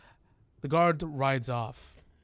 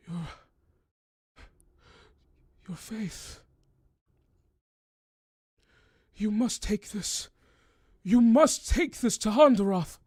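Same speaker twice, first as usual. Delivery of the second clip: high, pleading, swelling to a loud final plea; desperate old man; 10 semitones higher, 7 dB louder, slower than usual. Acted. The wounded old man begs the cleric to carry the box, from hushed to loud. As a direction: start hushed, then cry out the plea.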